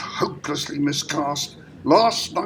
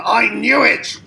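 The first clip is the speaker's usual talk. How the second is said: louder, higher self-reproach; exasperated raised voice; angry outburst; 6 semitones higher, 5 dB louder than usual. Acted. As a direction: burst out in frustration. Director rages at his own mistake.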